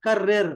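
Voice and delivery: कर्रिर said loud, rolled rr stressed; emphatic repeat. Shown as a demonstration. Teacher drills the word for the class. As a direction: punch कर्रिर loudly. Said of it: "once more".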